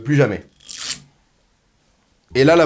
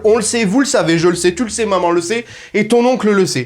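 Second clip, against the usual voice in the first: ordinary talk, nothing marked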